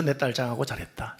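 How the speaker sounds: whispered praise. Retold or imitated. Father praising daughter at party.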